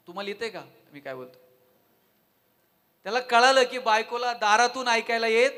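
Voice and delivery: usual speaking voice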